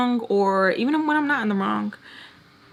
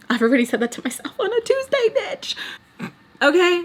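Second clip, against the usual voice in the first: high, fast, climbing comeback; sassy retort; 9 semitones higher than usual. Acted. Telling critics she already insulted herself, sassy retort. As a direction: race upward into a squeaky, sassy finish.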